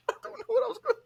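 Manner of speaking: usual speaking voice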